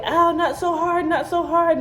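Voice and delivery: high wincing plea to ease up; pained protest. Retold or imitated. Mother complaining to the doctors stitching her, high and wincing. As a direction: wince and beg them to go gentle.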